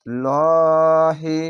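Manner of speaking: লহি drawn out slowly with a full, heavy l. Shown as a demonstration. Teacher showing students the heavy লহি in Allah. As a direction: stretch লহি long with a heavy, rounded l. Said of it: "মোটা করা হবে".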